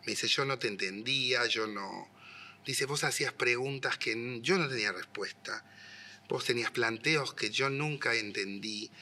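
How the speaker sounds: soft, subdued retelling of a mother's words; hushed and tender; gentle confession. Retold or imitated. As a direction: confide it quietly, with pauses.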